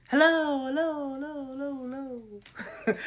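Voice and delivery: high, drawn-out hellos fading like an echo; mock calling out. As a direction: call into an empty room, letting each hello trail away. Calling out after no answer.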